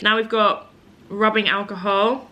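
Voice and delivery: ordinary talk, nothing marked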